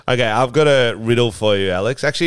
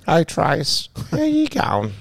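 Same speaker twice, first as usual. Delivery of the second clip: high sing-song put-on lady voice, much higher; mock bingo lady greeting; 7 semitones higher, slower than usual. Friend greeting Tracy at bingo, high mock lady voice.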